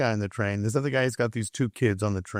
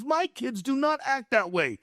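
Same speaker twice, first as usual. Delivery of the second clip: imitated indignation, pitched very high; high, worked-up mock outrage; pissed off; 11 semitones higher than usual. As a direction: act out a fed-up man ranting in a high voice. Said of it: "he's getting pissed off".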